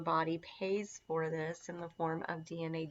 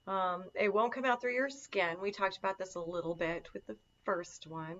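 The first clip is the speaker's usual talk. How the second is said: normal talking voice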